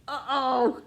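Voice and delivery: high, loud uh-oh; alarmed storybook uh-oh. A narrator's reading. Snore wakes everyone.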